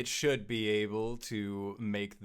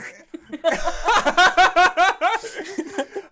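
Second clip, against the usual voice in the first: loud, high burst of laughter; 15 dB louder than usual. Real, not acted. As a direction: break into helpless laughter. Table cracks up at the voice changer.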